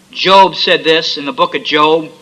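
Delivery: ordinary talk, nothing marked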